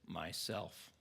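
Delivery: very low, quiet, deep voice. A narrator's reading. The lion answers who he is, in a deep low voice. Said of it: "in a very deep, low voice".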